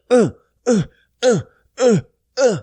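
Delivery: short, high, separate grunts; rhythmic grunts. Shown as a demonstration. Man demonstrates pumps to viewers, rhythmic grunting. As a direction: count out pulses as little grunts.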